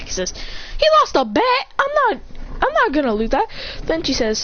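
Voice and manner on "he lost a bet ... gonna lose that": high-pitched mock protest; indignant protest. Acted protest at demand.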